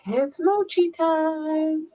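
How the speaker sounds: high, slow singsong announcement; slow high singsong for the pet; singsong to dog. Real, not acted. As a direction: draw it out sweetly for the dog.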